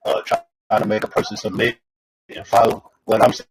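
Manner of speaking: phone breaking up; garbled, distorted phone line. Real, not acted. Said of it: "distorted in your last couple sentences".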